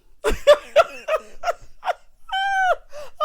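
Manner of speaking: long, loud, high squealing laughter; real shrieking laughing fit; helpless cackle. Real, not acted. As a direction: fall apart laughing. Cracks up at Dennis again.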